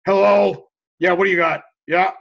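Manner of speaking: acted grumpy phone pickup; mad phone greeting. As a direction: answer the phone still annoyed. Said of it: "you're all mad".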